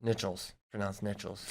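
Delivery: Nichols said with a "ch" as NITCH-ulz; pronunciation correction. Shown as a demonstration. Host correcting co-host's Nichols, quiet NITCH-ulz. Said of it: "Pronounced Nichols".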